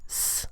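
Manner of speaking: "S" as a soft hiss, no voice; voiceless S sound. Shown as a demonstration. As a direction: let "S" be only air, a quiet sss. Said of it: "the S there is voiceless".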